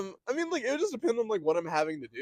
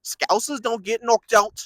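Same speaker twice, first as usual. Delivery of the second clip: put-on loud, slow, higher Scouse accent; Paddy the Baddy; 6 semitones higher, 7 dB louder, slower than usual. Imitating the fighter for friend, loud Scouse accent. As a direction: proclaim it in exaggerated nasal Scouse. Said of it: "Paddy the Baddy, like, the Scouse accent".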